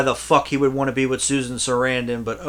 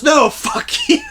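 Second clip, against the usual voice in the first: high, laughing comeback; laughing mock retort; 4 dB louder than usual. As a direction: blurt it back while cracking up. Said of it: "And he goes".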